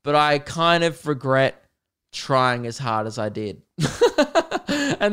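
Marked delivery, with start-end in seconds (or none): 3.8-4.9 high laugh. Real, not acted. Embarrassed confession.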